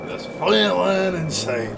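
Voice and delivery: slow loud movie quote. Pretend. Quoting movie line.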